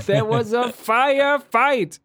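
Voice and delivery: high, slow dramatic quote voice; slow, over-the-top movie quote; movie line impression.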